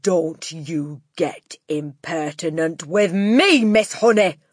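slow, loud, menacing rebuke; Trunchbull. A narrator's reading. Headmistress rebuking an impertinent teacher, loud angry warning. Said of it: "the Trunchbull snapped".

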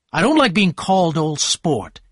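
narrated annoyance with firm emphasis; bad-tempered. A narrator's reading. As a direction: say it testily. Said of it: "said Tom in a bad-tempered way".

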